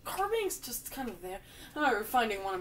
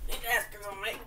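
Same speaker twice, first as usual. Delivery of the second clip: usual speaking voice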